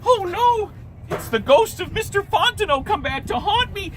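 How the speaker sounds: high, loud panicked cry; Louis sure the ghost has come, high and scared; frightened. Acted. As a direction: gasp it out in terror. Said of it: "Louis thought".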